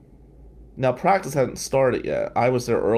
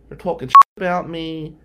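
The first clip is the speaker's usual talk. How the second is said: loud, higher, hurt; student protesting to his teacher; upset outburst; 6 semitones higher, 11 dB louder than usual. Retold or imitated. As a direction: vent to the teacher.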